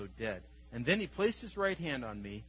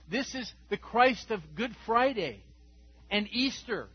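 ordinary talk, nothing marked

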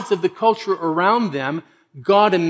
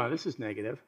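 much softer and lower; deflated reader's reaction; 4 semitones lower, 14 dB quieter, faster than usual. Retold or imitated. Imagined Bible reader reacting to the prophets, quietly put off. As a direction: drop to a low, quiet grumble. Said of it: "you read it and you go".

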